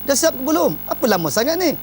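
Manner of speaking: mock irritated where's-my-drink; impatient demand. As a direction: demand it like a husband whose love has cooled. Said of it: "itu perubahan intonasi suara".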